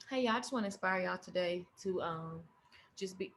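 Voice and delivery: soft, flat and sinking; depressing tone. Retold or imitated. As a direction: deflate as you speak. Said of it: "How's you looking depressing?".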